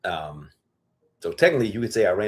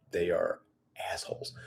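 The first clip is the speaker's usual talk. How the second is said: whispering; 2 semitones lower, 9 dB quieter than usual. Real, not acted. Muttered insult about chickens.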